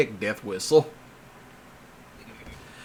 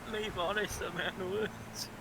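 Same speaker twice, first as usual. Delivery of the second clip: quiet repeat; 5 semitones higher, 16 dB quieter than usual. Retold or imitated.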